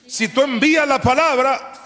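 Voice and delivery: high, loud shouted repetition; full-force preaching again. Acted. Preacher repeating his promise to the church, shouting.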